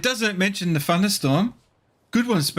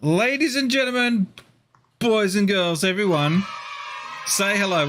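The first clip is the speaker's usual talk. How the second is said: higher, ringmaster-style crowd intro; grand entrance announcement; 5 semitones higher than usual. Acted. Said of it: "introduces guest to stream audience".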